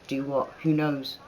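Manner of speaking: ordinary delivery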